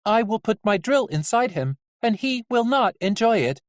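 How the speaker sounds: high, even-toned threat; high-pitched deadpan menace; menacing Nurse Ratched. Acted. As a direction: threaten matter-of-factly in a high voice.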